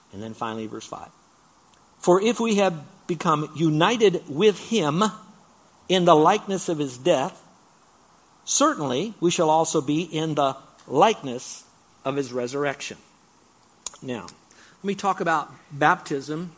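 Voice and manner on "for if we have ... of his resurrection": reading Romans aloud with preaching weight; preached scripture reading. Acted. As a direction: proclaim the verse to the church.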